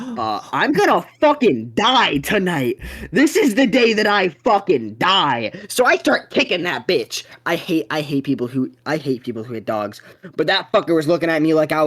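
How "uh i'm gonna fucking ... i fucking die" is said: slow, higher panicked dread; mock terror. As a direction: act out certain doom, slow and pitched up. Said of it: "I'm like".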